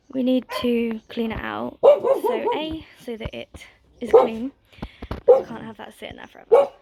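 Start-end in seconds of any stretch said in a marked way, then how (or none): 1.8-6.7 raised voice. Real, not acted. Scolding the barking dog.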